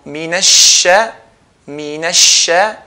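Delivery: "minasha" slow, the doubled sh held; slow shadda demo. Shown as a demonstration. Teacher drills the doubled sheen slowly for students. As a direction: stretch the sh in "minasha".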